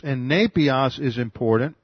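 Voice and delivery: usual speaking voice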